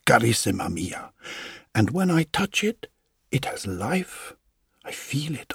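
a loving husband murmuring reassurance; tender coaxing husband. Acted. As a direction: hush and coax her with hope. Husband coaxing grieving wife.

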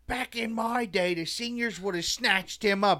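high, slow mock gym-teacher rant; gym teacher yelling. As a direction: imitate a gym teacher telling kids off. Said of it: "our gym teacher yelled at us all".

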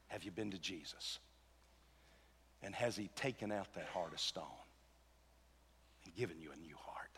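low, soft, near-whispered questions; hushed appeal. Real, not acted. Preacher asks church about a new heart, hushed appeal. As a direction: drop almost to a whisper, with long pauses.

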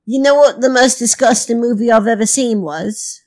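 hammed-up fake British accent; put-on British accent. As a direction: welcome listeners in a badly faked British accent. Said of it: "adopting a foreign accent".

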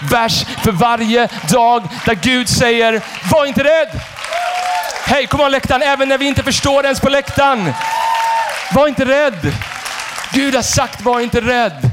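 high, emphatic preacher's proclamation; full-force preaching. Real, not acted. Pastor declaring God's promise to the congregation, high and forceful. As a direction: proclaim it slowly to the whole hall.

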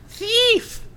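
high shrieking imp cry; giggly sneering imp. Acted. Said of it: "That's a weird voice".

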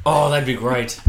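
normal talking voice

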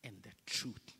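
slow, soft and low, into a whisper; whispered scripture. Acted. Preacher whispering Jesus' promise to the church. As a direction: breathe the verse out softly.

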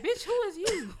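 loud, high-pitched incredulous question; mock indignant challenge. Onlookers challenge an overdressed party guest, mock indignant. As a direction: confront them, voice leaping up high and loud.

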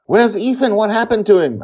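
high, loud frantic shouting; frantic demand. A narrator's reading. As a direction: shout the questions in alarm.

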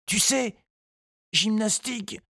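put-on high, light boy's voice; child voice. A narrator's reading. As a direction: voice a hopeful little boy. Tistou speaks to the pony.

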